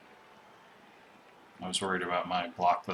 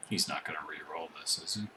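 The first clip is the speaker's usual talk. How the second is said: tense whisper; 3 semitones lower, 8 dB quieter than usual. Real, not acted. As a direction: whisper it, holding breath. Tense dice moment in game.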